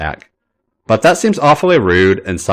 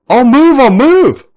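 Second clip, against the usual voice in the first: much higher, louder, slow frantic plea; begging; 7 dB louder than usual. A narrator's reading. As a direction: give in desperately mid-tickle. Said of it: "Finn begs".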